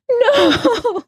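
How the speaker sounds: very high shocked cry of no; horrified no. Real, not acted. Gasp then no.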